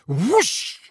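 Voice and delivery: high, airy whoosh noise; imitated whoosh. A narrator's reading. Owl bursts from the fireplace, imitated whoosh. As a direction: sweep the word like a sudden rush.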